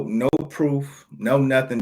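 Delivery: ordinary talk, nothing marked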